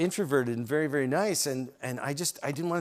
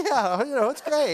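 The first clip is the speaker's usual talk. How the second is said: high giggling voice, louder; character laugh; 12 semitones higher, 5 dB louder than usual. Retold or imitated. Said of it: "And I developed this laugh".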